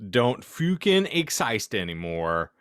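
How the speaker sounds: ordinary talk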